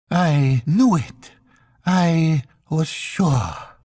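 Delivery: high, slow cry of triumph and pain; exulting anguished cry. Acted. Intended hearing her name, high slow anguished cry. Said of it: "exulting and terrible cry".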